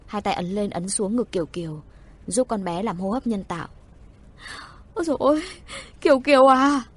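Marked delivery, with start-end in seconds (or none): 4.9-6.8 high, loud wail of a distraught mother; acted motherly lament, raised and loud; sobbing wail. A narrator's reading. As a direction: wail out over your child in anguish. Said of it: "Mẹ kế khóc tức tưởi".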